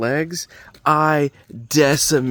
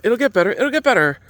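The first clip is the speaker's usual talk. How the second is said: high, louder parting encouragement; mock reassurance; 10 semitones higher, 6 dB louder, faster than usual. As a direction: call it back over your shoulder. Reassuring crying stranger, leaving.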